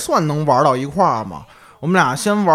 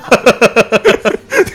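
rising burst of laughter; high laugh; 5 semitones higher, 5 dB louder than usual. Real, not acted. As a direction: crack up at the punchline. Laughing at the outhouse punchline.

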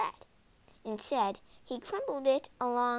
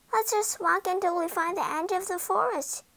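put-on high, keen little girl's voice; Gretel suggesting; 6 semitones higher, 4 dB louder than usual. A narrator's reading. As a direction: propose it as an eager child. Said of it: "suggested Gretel".

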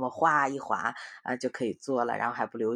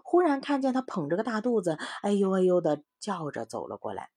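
story reading; 6 semitones higher, 5 dB louder than usual. A narrator's reading. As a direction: lively voice reading to children. Mother reads story to children. (给孩子讲故事的生动语气)

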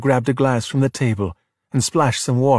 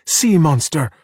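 mild, higher-pitched shout; 4 semitones higher, slower than usual. A narrator's reading. Distant shouts overheard by Ercole's gang, mildly shouted. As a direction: call it out in restrained alarm. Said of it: "heard the shouts of".

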